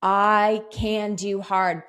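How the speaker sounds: firm, slow affirmation; slow mantra. Shown as a demonstration. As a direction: declare the mantra firmly. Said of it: "Have that be your mantra".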